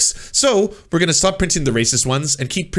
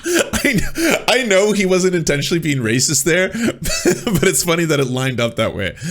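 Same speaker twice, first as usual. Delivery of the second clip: high laugh spilling into speech; amused laughter at the clip; 4 semitones higher than usual. Real, not acted. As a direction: laugh through the words. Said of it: "laughs at clip".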